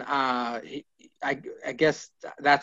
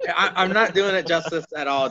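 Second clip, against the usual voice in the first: normal talking voice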